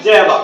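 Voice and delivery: "Java", said jeva, loud and short; native contraction. Shown as a demonstration. Teacher answers the quiz, contracted "Java". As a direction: squeeze did you ever into "Java". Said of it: "ওরা বলে যে কি?".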